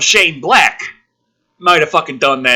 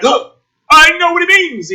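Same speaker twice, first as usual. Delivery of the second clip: mock confused character; 10 semitones higher, 4 dB louder than usual. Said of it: "she was like".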